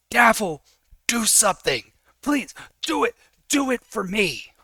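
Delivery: high, loud desperate shouting; worked-up yelling, pleading hard; distraught, angry plea. Acted. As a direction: beg your companion in anguish. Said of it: "confused and sad and angry".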